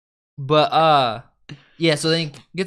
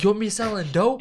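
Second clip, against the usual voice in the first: higher-pitched challenging question, imitated; mock confrontation, pitched up; retold threat; 7 semitones higher than usual. Fired worker threatening his ex-boss, heated imitated challenge. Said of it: "Jordan threatens him".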